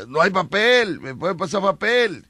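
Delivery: calling for help. Acted. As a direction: call out for paper, acted. Acts calling through bathroom door.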